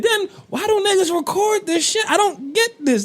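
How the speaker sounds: disbelieving high-pitched question; incredulous. Real, not acted. Incredulous rant about recording.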